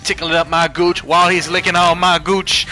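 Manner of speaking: building to a shout. Acted. Chant climaxes into yelling.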